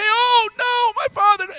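high, loud wail of loss; anguished cry. Acted. Esau crying to his father over the lost blessing, anguished wail. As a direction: cry out in despair to your father. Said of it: "he began to cry and say".

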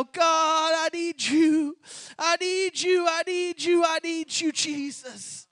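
loud pleading prayer, building then fading; fervent prayer. Acted. Preacher leading the altar call in pleading prayer. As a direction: cry out to God, then sink to a hush.

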